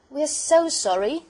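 put-on high, slow, heartfelt child voices; loud, drawn-out acted apology; apologetic. A narrator's reading. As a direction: plead for forgiveness as children. Children apologising to offended porter.

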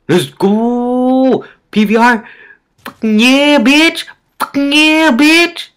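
loud, high victory yell; hyped celebration. Real, not acted. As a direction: shout out the win. Celebrating a good game.

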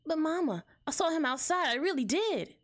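put-on high, pleading child voice; insistent bunny kid in a high voice; little kid. Junior pleads with Mama.

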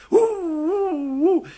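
high, long howl; imitated wolf howl. Storyteller voices wolves answering Scott, high howling. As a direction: howl like a far-off wolf pack.

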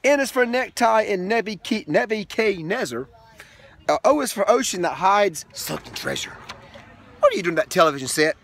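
lilting read-aloud rhythm; sing-song picture book reading; reading to children. Acted. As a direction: read the rhyming book to a toddler.

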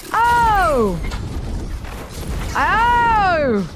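high drawn-out "oh" of dismay; pained cry. Real, not acted. Player getting hit in game, dismayed cry. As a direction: wail two long high ohs.

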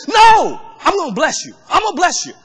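high, loud shouted No, then a mild mock shout; God shouting back. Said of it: "shouts back at him".

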